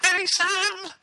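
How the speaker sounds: very high, slow robotic falsetto; sing-song auto-tuned robot voice. Pretend. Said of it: "you've been auto-tuned".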